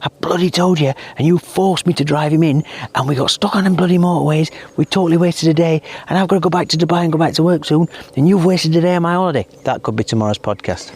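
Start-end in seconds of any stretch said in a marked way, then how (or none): 0.0-9.5 mock angry shouting. Husband blaming Laura on tomorrow's podcast, mock angry shouting. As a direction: pretend rant, pitched well up. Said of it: "I'm gonna be shouting at Laura".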